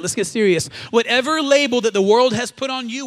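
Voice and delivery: ordinary delivery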